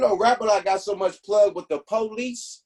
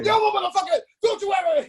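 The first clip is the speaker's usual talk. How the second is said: much higher, louder wrestler-style shout; Hulk Hogan yell; 12 semitones higher, 3 dB louder than usual. Retold or imitated. Cop turning tough again like Hulk Hogan, shouting. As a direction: bellow it like a pro wrestler. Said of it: "like goddamn Hulk Hogan".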